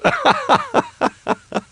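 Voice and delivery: high mocking cackle; high triumphant laugh; high gloating cackle. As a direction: laugh in their faces. Gloating after winning.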